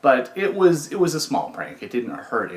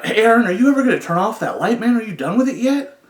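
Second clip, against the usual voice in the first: high, loud groggy grumble, hammed up; imitating a befuddled cellmate, loud and high; grumpy voice; 11 semitones higher, 8 dB louder, faster than usual. High, loud grumpy cellmate asking the light off. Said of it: "a very grumpy voice".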